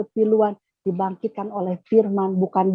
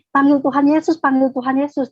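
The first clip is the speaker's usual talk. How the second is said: raised, pleading call to call on Jesus; urging him to call on Jesus, higher; urgent calling out; 5 semitones higher, 3 dB louder than usual. Retold or imitated. Urging dying husband, calling out.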